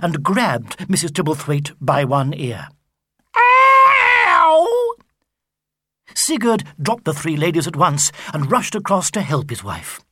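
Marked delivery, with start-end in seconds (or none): 3.3-4.9 sudden shrieking ow; very high loud yelp of pain. A narrator's reading. Mrs Tibblethwaite yelping as Boggis grabs her ear. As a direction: yelp as your ear is grabbed.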